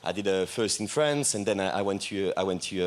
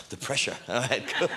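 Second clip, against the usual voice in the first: nervous laughter; 2 semitones higher than usual. Real, not acted. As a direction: chuckle through it, a bit embarrassed. Nervous laughter after audience cheers.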